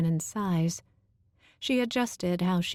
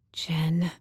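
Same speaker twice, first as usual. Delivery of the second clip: guilty whisper. Acted. Danny guilty, facing Jen.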